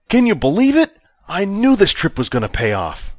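high and loud, thrilled; elated. A narrator's reading. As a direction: burst out with joy at the win. Vic elated at success.

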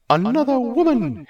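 high, loud scornful jab. Acted. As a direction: sneer at his interest. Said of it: "Hera stated scornfully".

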